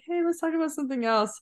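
a bright, hurried "let's talk about something else"; deflecting quote. Retold or imitated. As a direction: lightly mimic changing the subject, fast and high. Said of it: "I kind of just like".

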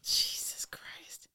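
slow, hushed whisper; whispered exasperation. Real, not acted. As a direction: whisper it slowly in disbelief. Exasperated aside at the co-host's answer.

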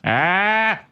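[name] yelp. Acted. Sidekick barks a bandleader yelp at the joke. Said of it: "That's my [name]".

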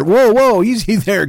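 high, loud mock-startled whoa; startled reaction. Priest to robot-voiced man in confession booth, startled. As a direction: cut him off in mock alarm.

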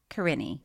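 Corinne as a low, falling kuh-RIN-ee; sounded final E. Shown as a demonstration. Reader unsure of a name, Corinne with low final EE. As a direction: end Corinne in a dropped EE. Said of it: "pronounced the E at the end".

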